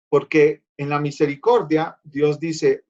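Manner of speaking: ordinary delivery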